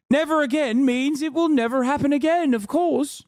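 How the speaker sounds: sarcastically naive high voice; mock sincerity. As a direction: recite it in a high, falsely earnest voice. Sarcastic take on a slogan.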